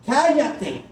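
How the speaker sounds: high, sharp hush; crowd shouting. Acted. Crowd silencing the blind beggar, sharp shout. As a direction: shut him up. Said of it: "Muchos le gritan".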